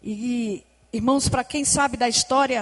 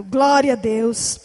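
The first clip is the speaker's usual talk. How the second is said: ordinary delivery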